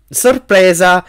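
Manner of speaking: bright, loud, high cry of surprise; spring the happy surprise on him; joyful exclamation. A narrator's reading. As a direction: Mrs Weasley greeting Harry, a joyful loud cry. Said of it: "esclamò gioiosa".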